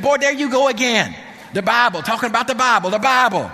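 loud, high mock scolding; mad mama. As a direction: imitate a fed-up mother telling off her son. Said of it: "my mama got mad at me".

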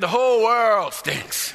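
a hint of a cranky old grandpa; grandpa complaining. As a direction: stretch it out like a fed-up grandpa. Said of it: "He said".

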